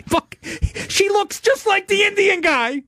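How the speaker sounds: very high, drawn-out laughing squeal; laughing through words. Real, not acted. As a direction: barely get it out through laughter. Cracking up while retelling the mix-up.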